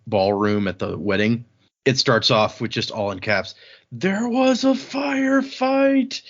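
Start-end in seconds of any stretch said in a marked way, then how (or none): 3.9-6.2 much higher, very slow drawn-out movie-villain delivery; Boondock Saints quote. Retold or imitated. Podcaster quoting his episode blurb in a slow, much higher movie-villain voice. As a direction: stretch it out like a crazed film villain. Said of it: "it's a quote as from that guy from, uh, Boondock Saints".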